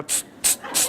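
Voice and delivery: short, voiceless tss sounds; soft little hisses, one after another; imitated whisperer hiss. Speaker jokes about the child whisperer, soft imitated hissing.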